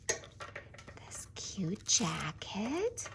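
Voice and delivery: slow soft whisper; ASMR whisper. Real, not acted. Host whispers to viewers while dressing the doll, slow. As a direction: whisper close to the mic, unhurried.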